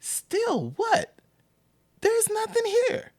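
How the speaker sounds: very high, indignant retold retort; acted-out incredulous comeback; incredulous challenge. Acts out mom challenging the officer.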